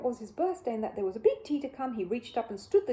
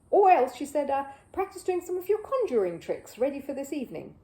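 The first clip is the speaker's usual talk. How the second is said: higher, louder coaxing voice; coaxing mother; 5 semitones higher, 6 dB louder than usual. Acted. Missus Brown redirecting Paddington, high and coaxing. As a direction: tempt him into trying.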